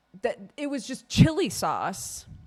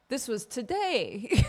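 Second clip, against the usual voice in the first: laughing; 4 semitones higher, 3 dB louder than usual. Real, not acted. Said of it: "This was this morning".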